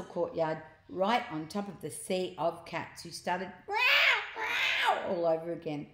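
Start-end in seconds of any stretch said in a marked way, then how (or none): 3.7-5.1 high, loud mock cat meows; piercing cartoon cat meow; cat yowls. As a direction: yowl like a startled cat. Narrator acts out yowling cats.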